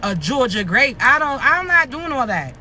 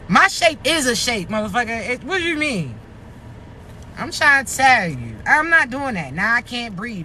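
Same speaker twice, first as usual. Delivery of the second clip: ordinary talk, nothing marked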